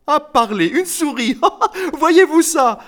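very high, loud, laughing disbelief; mocking laughter. A narrator's reading. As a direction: hoot with laughter at the child. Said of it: "rirent de bon cœur". (moquerie aiguë, en riant)

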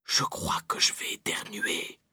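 slow whisper before a sneeze; about to sneeze. A narrator's reading. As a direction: hold back a sneeze while whispering. Girl about to sneeze while hiding.

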